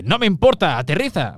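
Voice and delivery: high-pitched hero's protest; Anakin. Acted. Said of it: "tiene la voz así un poco aguda".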